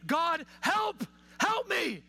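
high, urgent cry for help; acted desperate plea. Preacher voicing drowning Jonah's prayer, acted desperate plea.

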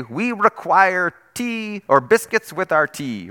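a pastor's self-confessed bad British accent; mock British accent. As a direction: play a posh British king asking for tea. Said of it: "I can't do a British accent".

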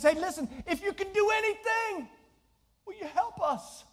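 high-pitched, urgent appeal; desperate appeal in a high voice; pleading father. Acted. Father begging Jesus for help.

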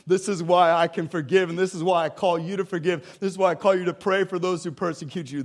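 preacher declaring salvation to the congregation; full-force preaching. Acted. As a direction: proclaim it to the whole church. Preaching Jesus's words loudly.

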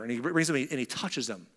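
ordinary talk, nothing marked

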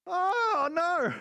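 high, loud theatrical wail; exaggerated anguished "oh no"; mock dismay. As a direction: cry out in hammed-up despair. Acts dismayed reaction to hard teaching.

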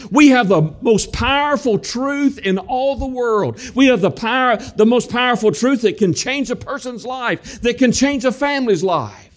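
high, ringing preacher's cry; fervent preaching. Acted. Preacher rousing the church about the gospel, fervent high preaching. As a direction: proclaim it to the whole room.

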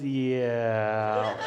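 low, long drawn-out yeah; reluctant confirmation. Retold or imitated. Friend confirms the cut needs care, drawn-out reluctant yes. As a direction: stretch it out as bad news, sliding down.

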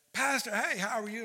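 high, eager hello; excited greeting. Retold or imitated. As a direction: imitate an excited church member greeting the pastor. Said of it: "they're all excited".

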